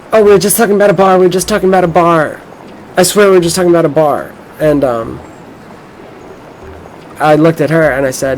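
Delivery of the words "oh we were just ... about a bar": fast, high imitation of a girl explaining; hasty mimicked protest. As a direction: rush out a pleading excuse in a raised pitch. Girl hastily explains to him.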